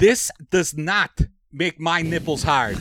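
lightly put-on Chicago accent, slow; slow, mock Ditka-style Chicago accent. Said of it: "I gave him a Chicago accent".

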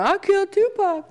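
high-pitched imitation of a dim braggart; silly high confession voice; mock boasting. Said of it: "walking around going".

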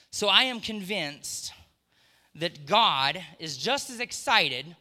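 slow, loud preaching build. Acted. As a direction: drive the point home from the pulpit. Preaching at full force.

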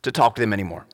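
mock impatient dismissal; impatient rant, starting high and quick. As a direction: act out writing someone off. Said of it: "we become impatient with them".

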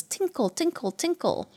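high, soft "tinkle" like tiny bells; light, high bell sound; tinkly bell imitation. Shown as a demonstration. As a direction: make the words chime lightly. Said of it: "light noises".

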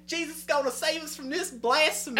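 a churchy lady, pitch up and fervent; pious lady. Retold or imitated. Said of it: "one lady goes".

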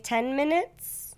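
usual speaking voice

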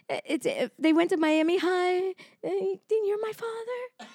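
high, small, halting caller; role-played voicemail. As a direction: timidly plead into the machine. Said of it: "You're gonna leave your message".

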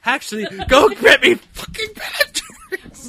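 high, loud, breaking into laughter; hysterics. Acted. Demanding ice cream from elf, hysterical mock-angry screech. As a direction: shriek the demand through helpless laughter. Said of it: "like, hysterics right now".

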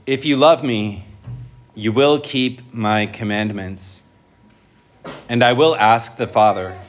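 measured, solemn liturgical reading; gospel proclamation. Real, not acted.

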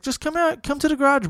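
high, coaxing come to the garage; insistent host. Retold or imitated. Host urging the friend into the garage, high and insistent.

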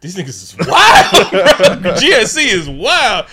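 laughing. Real, not acted. Laughing at the film scene.

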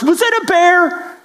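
squeaky blurted question; mock high-pitched stranger blurting out. Man erupts with absurd question.

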